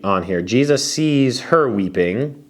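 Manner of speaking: ordinary delivery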